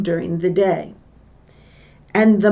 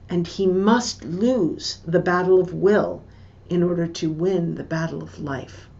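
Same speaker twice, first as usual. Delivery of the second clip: usual speaking voice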